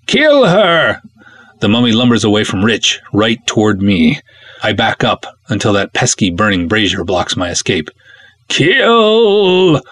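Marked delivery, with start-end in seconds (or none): 0.1-1.0 high, loud furious command; screamed order. A narrator's reading. Hawas screams at the mummy to kill. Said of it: "Hawas screams". 8.5-9.8 high, loud drawn-out scream; screamed command. A narrator's reading. Hawas screams one word at the mummy. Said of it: "Hawa screams".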